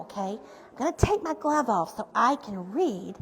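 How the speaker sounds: ordinary delivery, nothing marked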